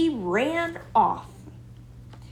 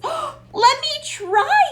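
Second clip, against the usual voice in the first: much higher, slow and eager; eager cry; 5 dB louder than usual. A narrator's reading. Rosie begs her brother to let her try cartwheels, excited. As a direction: beg for a turn, thrilled. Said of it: "cried Rosie".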